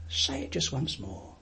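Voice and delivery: a quiet prompting voice; hushed inner voice. Retold or imitated. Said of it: "Then the little voice said".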